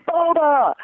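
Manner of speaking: retold call across the store, pitched high. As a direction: call the name out high to get attention. Calls to stranger in store.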